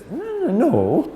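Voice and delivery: a slow, reluctant, unconvincing no; hesitant no. Retold or imitated. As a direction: hem and haw before saying no. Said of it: "doesn't say no fast enough".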